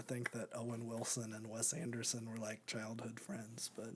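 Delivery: hushed whispered aside. Real, not acted. Aside about surprising friendship.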